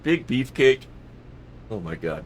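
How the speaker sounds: usual speaking voice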